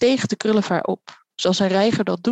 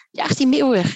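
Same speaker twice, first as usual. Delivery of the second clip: raised, higher-pitched order; calling out; 5 semitones higher than usual. A narrator's reading. Director ordering his men to chase off the gull, calling out. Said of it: "riep de directeur".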